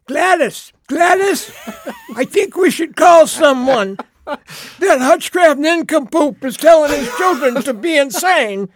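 high-pitched, hammed-up neighbor voice; mock the scandalized neighbor calling out; alarmed neighbor. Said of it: "next door neighbor is going".

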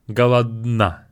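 Голодна with the d almost dropped; slow Голодна, just a hint of d; Голодна, d nearly silent; soft d. Shown as a demonstration. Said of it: "almost saying d, but not really".

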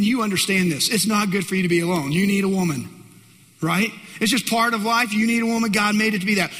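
ordinary talk, nothing marked

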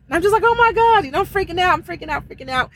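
frantic, spiralling freak-out voice; retold panic. Trucker reliving her trailer sliding on ice, retold panic.

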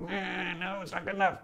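high, soft, sneering critic voice; hammed-up disapproving inner voice; mock inner critic. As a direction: sniff at it like a prissy inner judge.

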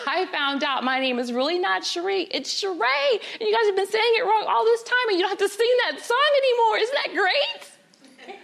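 high, breathless-paced rush of news; excited announcement. Acted. Child telling grandmother the real name, excited. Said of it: "I was so excited".

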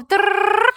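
rolled tongue drum roll; high trilled drum roll. Pretend. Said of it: "Drum roll".

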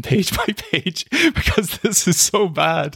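slow, high, laughing through the words; words breaking into amused laughter. Real, not acted. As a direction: crack up while saying it. Laughing as he quotes the angry notes.

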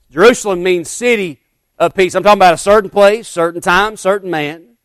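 loud, ringing revival preaching; preaching at full force. Acted. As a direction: preach it out to the whole church.

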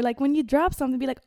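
usual speaking voice

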